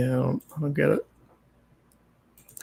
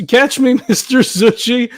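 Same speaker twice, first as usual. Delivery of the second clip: high, half-hearted yell with a laugh; mock yell; 6 semitones higher than usual. Player voicing the leap from the ladder, mock yell. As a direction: pretend to cry out, laughing. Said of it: "and then I just yelled".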